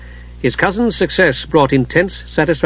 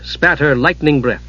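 usual speaking voice

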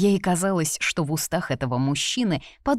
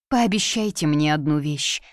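ordinary delivery

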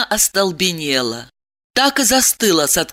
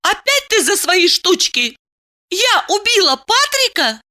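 high-pitched indignant woman; enraged; 13 semitones higher than usual. Acted. Woman challenges lover's murder accusation, enraged. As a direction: fling the question back up high. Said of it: "Диана пришла в ярость".

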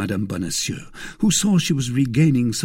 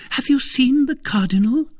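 very high, louder questioning wife; astonished cry; 5 dB louder than usual. A narrator's reading. Wife questioning her husband about the Cardinal, astonished. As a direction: demand it in astonishment. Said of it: "cried Madame Bonacieux".

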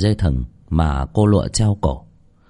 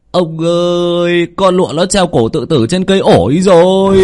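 high, loud frightened cry to the master; panicked report; 9 semitones higher, 6 dB louder than usual. Acted. Servant panics telling the old master of the hanging. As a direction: blurt it out in terror.

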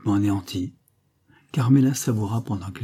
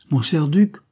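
higher-pitched, cutting address; 6 semitones higher, 4 dB louder than usual. A narrator's reading. Creole answering the false duke, in a cutting tone. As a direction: cut like a knife blade. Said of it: "d'un ton tranchant comme la lame d'un couteau".

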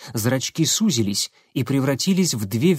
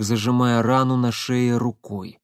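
ordinary delivery, nothing marked

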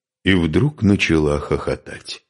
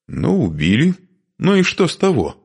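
higher, quarrelsome retort; querulous; 12 semitones higher, 3 dB louder than usual. A narrator's reading. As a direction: answer back peevishly, pitch climbing at the end. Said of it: "сварливо отозвалась женщина".

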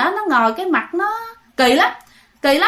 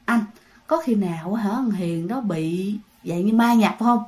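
hushed, low and softer; scared girlfriend murmuring close to his ear; whispering into his ear; 4 semitones lower, 5 dB quieter than usual. Retold or imitated. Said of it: "nói nhỏ nhỏ vô lỗ tai của bạn".

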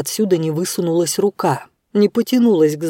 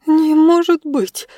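high, loud, slow cry of shock; weak exclamation; 10 semitones higher, 4 dB louder, slower than usual. A narrator's reading. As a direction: gasp it out in disbelief. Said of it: "слабо воскликнула она". (слабое восклицание)

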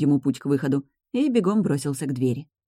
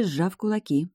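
plain reading voice

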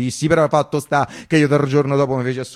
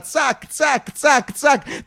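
high sharp snips; playful scissor-snip noises; snipping sound; 10 semitones higher, much slower than usual. Retold or imitated. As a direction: mimic the shears cutting, zac zac. Imitating the scissors cutting wires.

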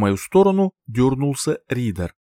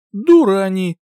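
loud, higher, cross outburst; spiteful grumble; 7 semitones higher, 6 dB louder than usual. A narrator's reading. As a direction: spit out the insult crossly. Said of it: "Злобно буркнула".

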